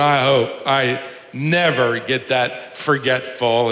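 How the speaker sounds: ordinary talk, nothing marked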